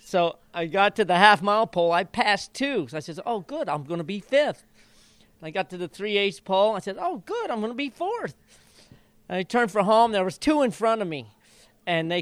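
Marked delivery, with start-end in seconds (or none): 3.2-4.6 talking to himself, cautiously glad; hopeful. Retold or imitated. Jockey's inner voice passing horses, mild hope. Mid-race inner thoughts. 7.0-8.4 much higher, eager and delighted; the thrill climbs as he passes another; rising excitement. Retold or imitated. Jockey's inner voice gaining places, higher excitement. Mid-race inner thoughts.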